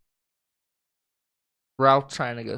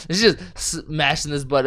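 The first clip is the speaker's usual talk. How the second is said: ordinary talk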